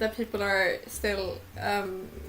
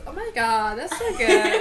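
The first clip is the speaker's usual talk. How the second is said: pirate voice; 2 semitones higher, 5 dB louder than usual. Acted. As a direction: ham up a joking pirate. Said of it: "my pirate voice is".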